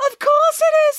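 very high, loud squeal; triumphant squeak; gleeful agreement. Acted. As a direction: burst out in delighted agreement. Nanny gleefully agrees it is crazy.

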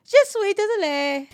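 "Je suis désolé" high and loud in a hammed-up French accent; bad French accent. Shown as a demonstration. Copying her partner, "Je suis désolé" in an overdone French accent. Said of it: "try out different accents".

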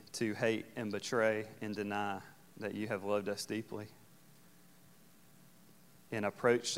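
hushed, reverent congregational prayer. Real, not acted. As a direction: speak softly to God, unhurried.

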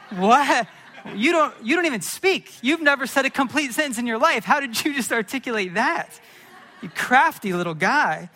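high, loud, astonished dad; mock incredulous. Father to his suddenly articulate toddler, mock incredulous. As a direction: burst out in comic disbelief.

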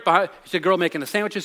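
ordinary delivery

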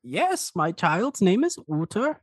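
put-on high-pitched elderly dragonborn with a European accent; old dragonborn man. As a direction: greet them as a high-voiced old uncle. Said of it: "and the old man just goes".

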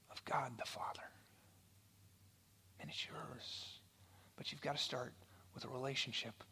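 very soft, whispery preaching; quiet, breath-light sincerity; hushed and earnest. Real, not acted. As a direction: drop to a near whisper for the appeal. Preacher's hushed climax continues.

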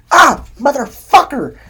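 retold yell, high, loud and drawn out; startled yell. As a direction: blurt it out loud and slow, as if jumped at.

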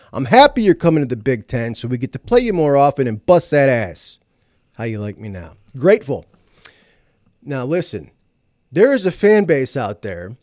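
usual speaking voice

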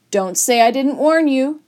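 high, loud, bluffing declaration; emphatic storybook threat; declared warning. A narrator's reading. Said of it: "I declared".

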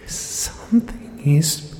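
high, slow, breathless whisper; terrified whisper. Acted. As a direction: gasp it out in terror. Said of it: "a deranged burst of breath".

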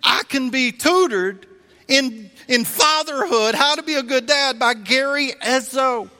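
gushing with disbelief at his luck; excited inner cheering. Retold or imitated. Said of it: "My brain is going".

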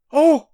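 high, loud cry of shock; shocked gasp. A narrator's reading. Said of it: "Sinetta gasped".

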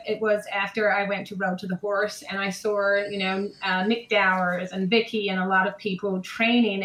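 put-on American accent. Shown as a demonstration. Guest tells host what changed her, American accent. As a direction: answer steadily in an American accent. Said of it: "how about your American accent".

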